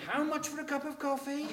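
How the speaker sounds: high, laughing mock outrage; shocked price question. Son imitating parents shocked at Starbucks coffee price, high mock outrage. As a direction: squeal it like a shocked pensioner.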